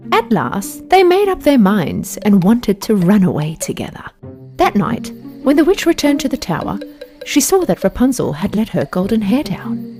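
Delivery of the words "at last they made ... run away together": usual speaking voice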